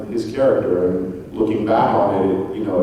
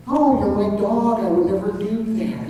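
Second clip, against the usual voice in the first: Owen Wilson impression; 12 semitones higher than usual. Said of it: "he's got kind of a higher pitch thing".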